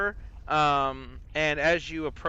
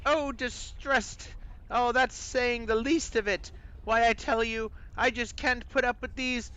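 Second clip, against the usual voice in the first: high-pitched, put-upon British voice; British accent; 10 semitones higher than usual. Acted. Distressed skull answers the adventurer, high British accent. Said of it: "a British accent".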